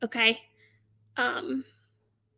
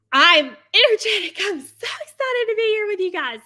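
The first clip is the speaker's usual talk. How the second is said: higher, louder, bubbly with a laugh; energetic live host; 7 semitones higher, 6 dB louder than usual. Shown as a demonstration. Seller shows the energy she brings when live. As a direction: burst onto the live like you're caffeined out. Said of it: "like, full of energy".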